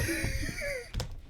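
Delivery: high, wheezing fit of laughter; helpless laugh. Real, not acted. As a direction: crack up until the voice squeaks. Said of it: "just took me out".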